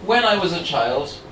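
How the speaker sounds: measured, gentle poem read; reading verse at an open mic. Acted. Host reads poem aloud.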